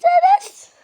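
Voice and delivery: high, loud yell of the name; angry call. Acted. Calling Deniz after the mischief, angry yell. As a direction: shout out to summon someone in trouble.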